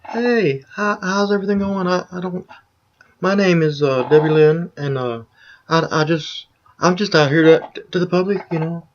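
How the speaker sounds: high, even, mock introduction voice; high-voiced parody of a proper greeting; put-on polite voice. Shown as a demonstration. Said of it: "I don't have to sound".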